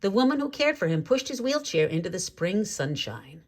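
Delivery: ordinary delivery, nothing marked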